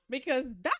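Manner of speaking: usual speaking voice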